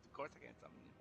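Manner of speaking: sudden deep voice break; voice cracking. Real, not acted. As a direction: voice drops and cracks mid-line. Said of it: "Why did my voice break like that?".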